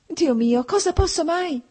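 high-pitched, distressed plea; acted helpless wail before crying; near tears. A narrator's reading. Fiancée at a loss with him, tearful exclamation. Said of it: "disse lei e cominciò a piangere".

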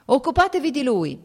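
loud, high call to a comrade; shouted order. Acted. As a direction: bark the instruction across the party. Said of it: "gridò a Yashvin il comandante".

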